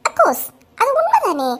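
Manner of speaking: high, giggling tease; laughing mockery. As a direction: laugh at her while talking. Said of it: "உங்களுக்கு எல்லாம் சிரிப்பா இருக்கு".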